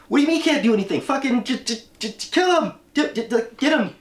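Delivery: high, loud stammering rage; mock furious Lex. Lex raging at the helpless city, high stammering shout. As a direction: sputter with fury, barely forming words. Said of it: "And Lex is like".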